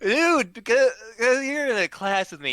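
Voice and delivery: put-on loud, insistent drunk plea; begging a near stranger for help; drunk pleading.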